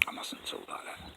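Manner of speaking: whispered under his breath; self-scolding whisper. Real, not acted. Vlogger chiding himself for what he said, whispered. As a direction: hush it to yourself.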